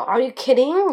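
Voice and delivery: incredulous. Acted. Shocked reply in example.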